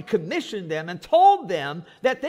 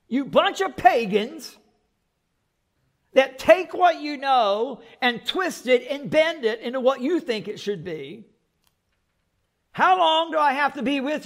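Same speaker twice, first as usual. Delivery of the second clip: louder, higher, fed-up scolding of the crowd; exasperated rebuke; 4 semitones higher, 4 dB louder than usual. Retold or imitated. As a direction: lash out at a faithless crowd, fed up. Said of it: "If y'all think Jesus didn't have emotions".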